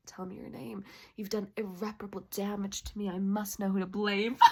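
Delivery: low, very soft, intense murmur; hushed romantic lead. Acted. Wanting a man to look at her, hushed and low. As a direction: murmur it low, like a smitten lover. Voices the man she wants.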